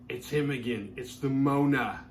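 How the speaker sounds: slow, overdone put-on accent, leaning hard on "Mona"; mock exaggerated movie accent. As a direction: lay the movie accent on thick, drawing out "Mona". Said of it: "Jess really has an exaggerated accent".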